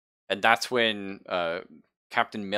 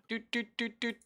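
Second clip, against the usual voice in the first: high beeping "doot doot"; computer beeps; 6 dB quieter than usual. Acted. GM voicing the ship computer's high beeps. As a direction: chirp it like a terminal beeping. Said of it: "Mother spits out".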